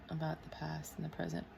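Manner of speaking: very soft note to self; hushed self-dictation; murmuring while writing. Real, not acted. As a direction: say it under your breath as you jot. Murmurs words while writing them down.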